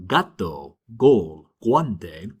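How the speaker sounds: gato, gol, guante starting with a hard G; G as in "leg" for gato, gol, guante; hard G sound. Shown as a demonstration. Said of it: "what we call a hard sound".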